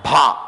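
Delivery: "భా" with breath after the b; stressed breathy release on "భా"; aspirated bha. Shown as a demonstration. Teacher shows how ba gets aspirated, "భా" as bh. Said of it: "బాని ఎట్లా పలుకుతాం?".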